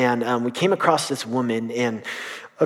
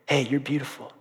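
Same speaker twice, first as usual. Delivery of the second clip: tender; 6 dB quieter than usual. Retold or imitated. Boy repeating phrase to weeping woman.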